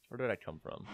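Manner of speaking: ordinary talk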